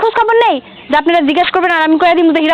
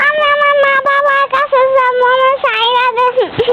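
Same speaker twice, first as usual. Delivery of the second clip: distressed pleading; 7 semitones higher than usual. Acted. Child begs to go back to parents, distressed pleading. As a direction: plead to be let go, stretching the words high.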